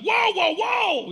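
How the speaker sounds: shouted whoa, high and loud; high, loud whoa to stop a son charging a man; alarmed shout. Retold or imitated. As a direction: yell to stop a kid mid-charge.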